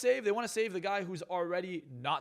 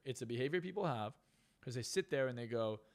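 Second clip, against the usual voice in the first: normal talking voice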